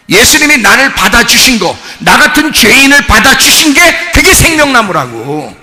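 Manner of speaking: loud, emphatic preacher's climax; impassioned preaching. Real, not acted. Pastor telling the congregation Jesus accepts sinners, impassioned preaching.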